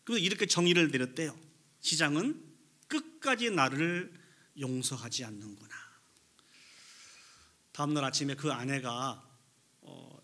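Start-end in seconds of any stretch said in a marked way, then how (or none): none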